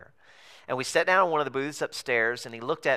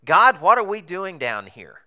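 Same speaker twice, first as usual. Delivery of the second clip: loud, high cry up to God, slowly; praying out loud; 7 semitones higher, 10 dB louder than usual. Retold or imitated. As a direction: call out to God in exasperated prayer. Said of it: "what I would say out loud".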